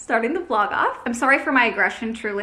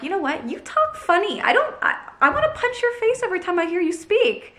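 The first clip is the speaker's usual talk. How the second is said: imagined hater; 7 semitones higher than usual. Retold or imitated. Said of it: "and be like".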